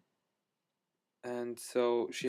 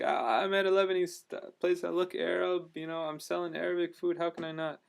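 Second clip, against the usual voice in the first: higher, indignant mock protest; imitating offended; 7 semitones higher than usual. Restaurant guy offended at being asked, high indignant mock voice. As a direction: play the insulted shopkeeper, half joking. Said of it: "like, offended".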